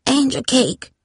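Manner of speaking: put-on high frail old woman calling; hoarse grandma voice. A narrator's reading. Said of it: "came Grandma's hoarse voice".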